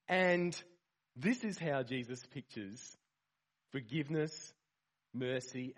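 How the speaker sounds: ordinary talk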